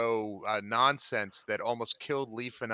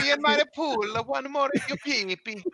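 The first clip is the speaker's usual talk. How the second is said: squeaky voice; 14 semitones higher, 4 dB louder than usual. Acted. Player riffs in squeaky voice.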